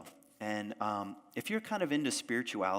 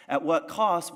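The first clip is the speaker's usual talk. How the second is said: louder and higher, pressing the question; emphatic repeat; 5 semitones higher, 8 dB louder, slower than usual. Shown as a demonstration. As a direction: hit it hard the second time. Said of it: "asks the question twice".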